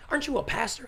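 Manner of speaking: high-pitched mock disbelief; incredulous question. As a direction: imitate a taken-aback woman asking. Retold challenge from surprised woman.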